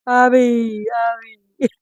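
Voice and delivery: mock crybaby calling Albie; dramatic child whine. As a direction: ham up a child's dramatic cry. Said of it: "she's so dramatic".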